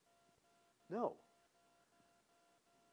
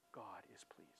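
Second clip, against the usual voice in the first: whisper; 8 semitones lower, 21 dB quieter than usual. Retold or imitated. Whispered inside quote.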